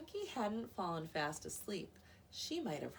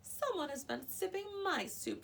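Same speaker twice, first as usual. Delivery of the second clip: growling; 12 semitones higher than usual. A narrator's reading. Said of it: "she growled".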